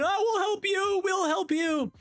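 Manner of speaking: put-on very high chirpy kids offering help; a crowd of high-pitched children volunteering; eager kids. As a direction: squeak it out like eager little helpers. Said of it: "we have everyone going".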